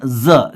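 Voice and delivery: ज said alone as "zuh"; ज voiced like English z; vibrating z sound. Shown as a demonstration. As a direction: demonstrate the buzz in ज. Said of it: "इसको बोलते वक्त vibration".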